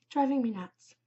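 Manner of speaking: ordinary talk, nothing marked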